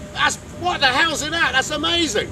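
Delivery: high, loud, amazed exclamation; a neighbour marvelling at foreign food, amazed. Retold or imitated. Neighbours tasting each other's food.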